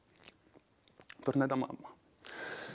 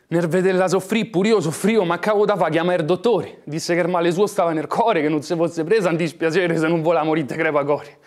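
loud; 3 semitones higher, 12 dB louder than usual. Acted. Distressed telling, raised voice.

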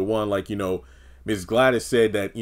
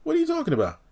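higher, whiny mock innocence; high defensive denial; 9 semitones higher, faster than usual. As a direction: play dumb in a much higher voice. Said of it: "It's like".

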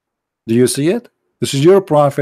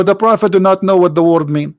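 ordinary delivery

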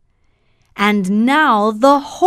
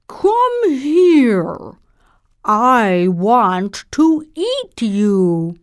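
cow voice; slower than usual. Acted.

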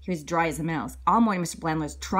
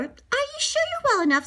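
high concerned principal. Acted.

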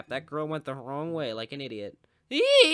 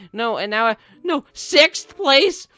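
high, loud, slow drawn-out wail; anguished wail; 13 semitones higher, 15 dB louder, much slower than usual. Real, not acted. Gamer falling to sixth place, drawn-out anguished wail. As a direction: wail the loss long. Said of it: "I'm not actually that mad".

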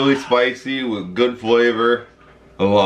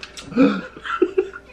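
sharp gasp; 14 semitones higher than usual. Real, not acted. Reaction to the burning sauce.

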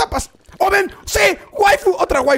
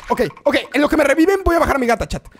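normal talking voice

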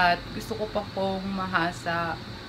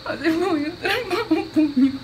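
high words squeezed through laughter; cracking up; 10 semitones higher than usual. Real, not acted. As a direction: lose it while reciting a sad plea. Cracks up over own sad story.

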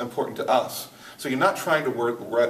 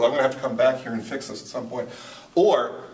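ordinary delivery

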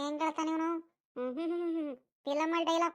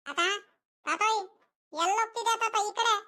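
put-on high-pitched boyish call; 6 semitones higher than usual. Grandson hails his passing grandfather, calling out high.

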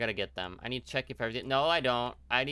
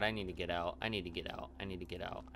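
low mutter, fading softer; trapped character's mutter; 6 semitones lower, 7 dB quieter than usual. Acted. Trapped character desperate to escape, low fading mutter. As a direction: repeat it low, sinking away.

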